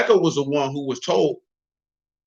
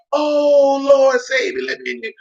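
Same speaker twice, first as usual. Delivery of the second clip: much higher, hammed-up plea; mock begging; 10 semitones higher, slower than usual. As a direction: beg to be let in, too late. Late pleaders begging entry.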